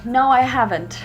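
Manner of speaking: ordinary talk, nothing marked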